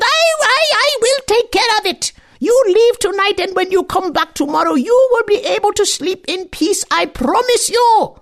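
high, hurried crone voice, anxious; flustered old woman. Acted. The old woman hastily calming her creatures, high and flustered. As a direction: quickly cover for the hidden girl.

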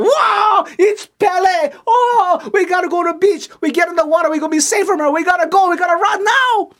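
high, loud, panicked shouting; terrified panic. Acted. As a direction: scream to flee to the water. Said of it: "Ka'avale take one look at that, go".

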